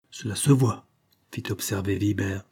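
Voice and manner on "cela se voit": usual speaking voice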